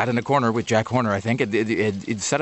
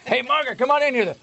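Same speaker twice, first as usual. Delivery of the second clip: put-on Louisiana accent; 11 semitones higher than usual. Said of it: "giving everybody a Louisiana accent".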